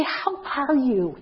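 slow, drawn-out how are you; gentle how are you. Shown as a demonstration. Woman greeting a visitor at the door, slow gentle. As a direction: ease into the greeting slowly. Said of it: "if you're a woman, you'd say".

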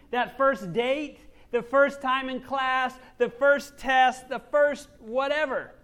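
each "first" leaned on, drawn out slowly; stress on first. Real, not acted. Preacher to congregation, slow, stressing each "first". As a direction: linger hard on every "first".

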